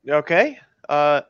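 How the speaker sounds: ordinary talk, nothing marked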